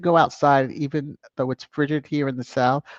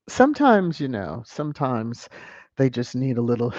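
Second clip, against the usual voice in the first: usual speaking voice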